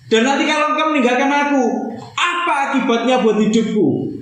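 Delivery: high, pleading cry to God; anguished lament. Acted. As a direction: plead in despair, voice pitched high. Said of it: "Dia lagi kecewa berat". (ratapan putus asa, nada tinggi)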